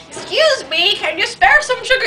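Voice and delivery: high-pitched put-on friend voice; imitating friend's voice. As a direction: mimic your friend in a high, silly voice. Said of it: "Yes, she really talks like that".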